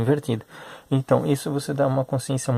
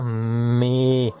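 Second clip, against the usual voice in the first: me drawn out long and slow; teacher sounding out a syllable slowly; slow blending demo. Shown as a demonstration. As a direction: stretch me slowly for a child. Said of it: "se eu pegar o hum e o E".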